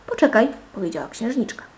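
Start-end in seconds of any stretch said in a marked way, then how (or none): 0.1-0.5 loud, high-pitched call; calling back. A narrator's reading. Princess halting her lady-in-waiting, calling back. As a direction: call out to stop someone leaving.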